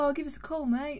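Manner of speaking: lightly mimicked Australian advert accent. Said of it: "those Foster guys".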